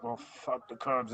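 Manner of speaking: slow, lightly voiced mock of Bob; a hint of Bob's voice, drawn out; imitating Bob's voice. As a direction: mimic the buddy's voice back at him, slowly. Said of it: "in his voice".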